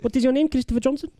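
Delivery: mock South African tough talk; South African accent. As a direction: put on a South African accent, playfully confrontational. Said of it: "wanna hear your entire South African accent".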